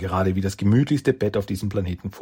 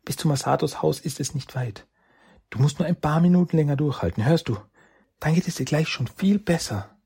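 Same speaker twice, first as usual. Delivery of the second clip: higher, quicker coaxing; urgent reassurance; 7 semitones higher than usual. Acted. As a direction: keep him awake with your voice. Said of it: "Der wohltuende Klang".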